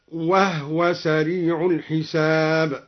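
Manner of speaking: "وهو" said wahwa, no vowel on the h; haa with sukun. Shown as a demonstration. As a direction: recite slowly, closing "وهو" on the h before w. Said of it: "وسكن الهاء من قوله تعالى". (تسكين هاء "وهو")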